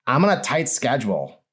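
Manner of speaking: a hint of a breathless rushed customer; gasped. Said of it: "she gasped".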